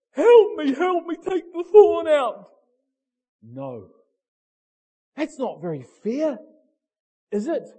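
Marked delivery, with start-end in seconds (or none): 0.1-2.5 high, loud begging cry; desperate plea. Acted. Paul crying out to God, loud desperate begging. 3.4-3.9 quiet firm no. Acted. God refusing Paul.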